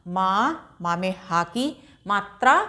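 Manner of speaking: usual speaking voice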